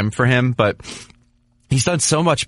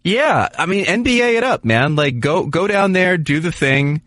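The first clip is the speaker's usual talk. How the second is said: excited; 9 semitones higher than usual. Real, not acted. Analyst urging Karlsson toward Tampa, much higher and excited. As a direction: hype him into chasing the money. Excited pitch for a one-year deal.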